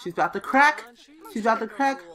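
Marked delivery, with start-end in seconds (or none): none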